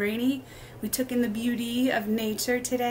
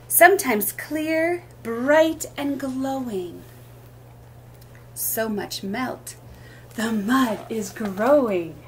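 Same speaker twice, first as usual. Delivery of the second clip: sing-song picture-book reading, lilting rhythm; reading to children; slower than usual. Acted. Teacher reading rhyming book aloud at storytime, lilting. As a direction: read each line like a gentle rhyme for little ones.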